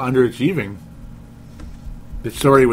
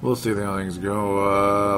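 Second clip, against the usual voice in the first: slow drawn-out repeat; 3 semitones lower, 3 dB quieter, much slower than usual. Shown as a demonstration. Podcast host echoing his doubt, slow and drawn out. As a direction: stretch each word out slowly.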